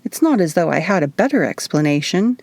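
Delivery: ordinary delivery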